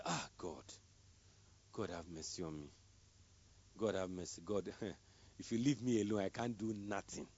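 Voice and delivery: drained, half-mumbled plea for mercy; tired mumbled prayer. Retold or imitated. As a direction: sigh it out like you're worn out. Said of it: "You come in, you are tired".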